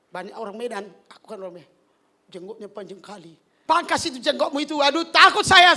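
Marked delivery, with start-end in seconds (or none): 3.6-5.7 mock-scared teasing. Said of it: "saya bilang".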